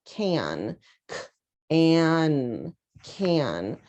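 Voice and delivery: can split into k and an, then blended; teacher segmenting can into k, an; sounding out. Shown as a demonstration. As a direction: sound it out slowly, sound by sound. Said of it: "listen to the first syllable".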